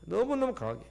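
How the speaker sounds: usual speaking voice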